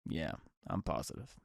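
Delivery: subdued retold reply. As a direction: drop right down to relay her text. Retells girlfriend's bad-news text.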